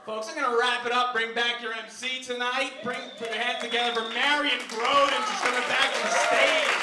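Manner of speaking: loud sign-off to the crowd; rally the applause for the host; stage announcer. Real, not acted. Closes set, announces the MC.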